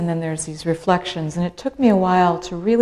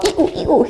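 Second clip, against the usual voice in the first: dinghies squeaking; 10 semitones higher than usual. Retold or imitated. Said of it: "and they're all".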